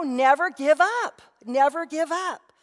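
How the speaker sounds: slow, high and loud "Never give up" twice; preacher's rising refrain; emphatic repeat. Acted. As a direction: drive the motto home slowly. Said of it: "preacher repeating the motto".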